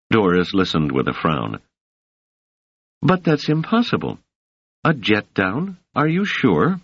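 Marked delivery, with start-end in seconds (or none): 3.0-6.8 surprised. Acted. Doris voice, disbelieving.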